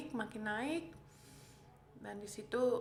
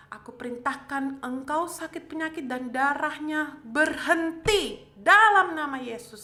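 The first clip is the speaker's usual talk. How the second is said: much louder, higher, thundering rebuke; commanding prayer; 5 semitones higher, 12 dB louder than usual. Retold or imitated. Patient orders sickness and bleeding to stop, retold shouted prayer. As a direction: build to a full-force shout on the final phrase. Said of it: "saya berdoa sama, sama darah itu".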